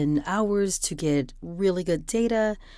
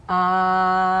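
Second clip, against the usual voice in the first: a long, steady, louder "Ah" held for the mic check; sustained ah; 3 semitones higher, 7 dB louder than usual. Shown as a demonstration. Testing the mic for the app, a held louder "Ah". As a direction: hold one open "Ah" tone evenly. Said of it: "I'm gonna say "ah" for three seconds".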